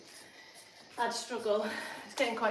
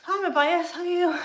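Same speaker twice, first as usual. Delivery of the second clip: high bright greeting; 6 semitones higher, 8 dB louder than usual. Real, not acted. Greets friend mid workout.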